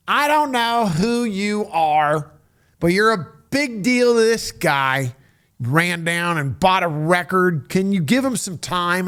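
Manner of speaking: a gruff boss doing someone a favour; bossy manager. Retold or imitated.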